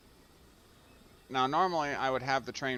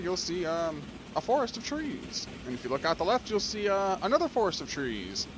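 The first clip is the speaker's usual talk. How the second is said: mock train conductor; 5 semitones higher than usual. Playing conductor to an empty freight train, mock announcer. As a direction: play the cheesy tour conductor. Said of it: "the whole conductor thing".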